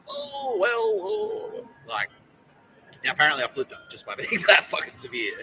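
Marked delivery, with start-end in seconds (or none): 0.1-1.7 very high, startled little squeak; mock flustered squeak. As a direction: squeak like they've been caught out.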